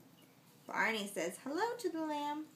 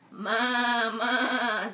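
a louder bleating ма ма; goat bleat; 7 dB louder than usual. Acted. Parent reading to child, loud goat bleat. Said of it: "says the goat".

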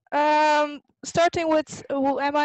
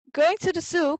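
higher, eager little bunny question; excited cry; 5 semitones higher, 4 dB louder than usual. Acted. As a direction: ask it like an excited child. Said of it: "cried Miffy Bun".

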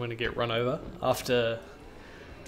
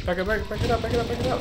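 high, frantic repeated orders; urgent, rising panic; panicking gamer; 10 semitones higher, faster than usual. Real, not acted. Panicked retreat in game.

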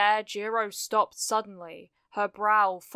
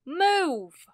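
high, urgent cry of alarm; sudden yell; 8 semitones higher, 4 dB louder than usual. A narrator's reading. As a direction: call out suddenly, pitch leaping up. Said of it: "she suddenly yelled".

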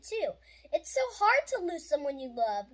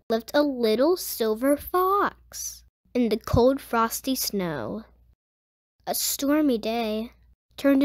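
put-on lower, slower read-aloud voice for a children's book; gentle storybook reading; 6 semitones lower, 8 dB louder, slower than usual. Host reading a grief picture book to young viewers, gentle and unhurried.

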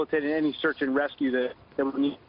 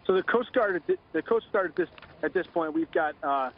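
phone line; 5 semitones lower than usual. Real, not acted. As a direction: voice over a telephone line. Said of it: "Joining us now on the phone".

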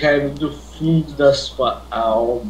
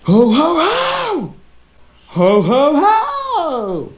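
loud jolly high ho ho ho; Santa Claus; 12 semitones higher, 6 dB louder, much slower than usual. Acted. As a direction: belt out a festive Santa laugh. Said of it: "É voz de Papai Noel". (risada de Papai Noel)

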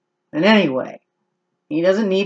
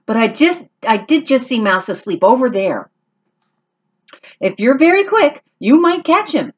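high, louder squeaky mouse; little mouse voice; 6 semitones higher, 3 dB louder than usual. Acted. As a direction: squeak it out eagerly. Mouse answers Max.

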